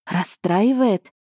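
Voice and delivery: slow, stunned repeat; gasped echo. A narrator's reading. Elya echoing the duke, stunned and slow. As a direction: drag the word out in shock. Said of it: "ахнула я".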